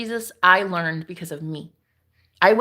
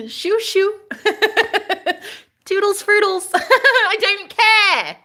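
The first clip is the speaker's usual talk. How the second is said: mock shooing; 12 semitones higher, 3 dB louder, slower than usual. Dismissing trolls playfully.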